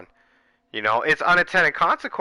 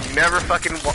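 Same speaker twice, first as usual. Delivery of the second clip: usual speaking voice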